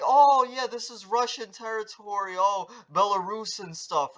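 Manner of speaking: lightly hammed-up impression of Belarus going along with Russia; mocking Belarus.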